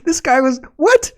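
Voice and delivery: very high, loud incredulous yelp. Retold or imitated. Host reacting to climber's table saw accident, incredulous. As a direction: squawk it in laughing disbelief. Re-enacting disbelief at the twist.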